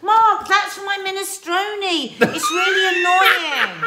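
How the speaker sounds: high, loud mock-exasperated telling-off; hammed-up nagging at a husband; wife hamming up a scolding over her peppers.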